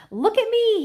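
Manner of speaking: high, loud excited shout; shouting while running. A narrator's reading. Mike shouts while running to the camera, excited. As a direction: yell it out as you run. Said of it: "he shouted as he ran".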